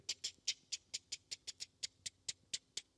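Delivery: whispered tick tick of typewriter keys. Retold or imitated. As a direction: tap out the typewriter sound under your breath.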